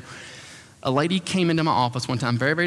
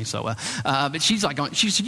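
normal talking voice

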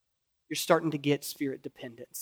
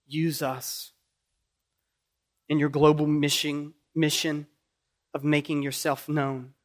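closing prayer; 4 dB louder than usual. Acted.

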